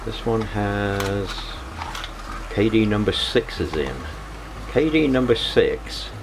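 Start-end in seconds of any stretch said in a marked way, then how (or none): none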